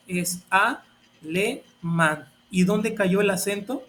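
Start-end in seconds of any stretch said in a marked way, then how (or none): 0.5-2.3 "alemán" broken slowly into a, le, mán, with clear pauses; slow, spaced-out teaching demo of "alemán" as a-le-mán; syllable by syllable. Shown as a demonstration. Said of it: "ya lo separé en sílabas".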